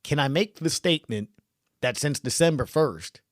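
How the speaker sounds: ordinary talk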